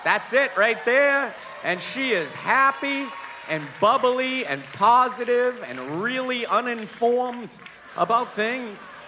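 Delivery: lightly voiced bubbly fiancee; mock cheerful. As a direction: paint her as blissfully clueless. Mock bubbly description, laughing.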